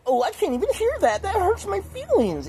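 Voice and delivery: very high, whiny put-on hurt voice; exaggerated high-pitched wounded feelings; overly sensitive. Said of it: "overly sensitive".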